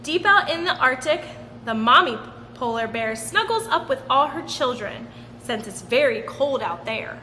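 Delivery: ordinary delivery, nothing marked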